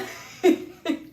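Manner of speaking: short, breathy unvoiced laugh. Real, not acted. Vlogger laughing at own trouble speaking, breathy laugh. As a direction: laugh it off without words.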